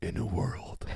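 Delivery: breathy trailer narrator opening; whispered movie trailer voice. Pretend. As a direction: hush it like an epic trailer. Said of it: "It'd be like".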